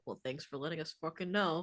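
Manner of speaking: sarcastic low grumble under the breath. Retold or imitated. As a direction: mutter it sarcastically to yourself. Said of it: "under your breath go".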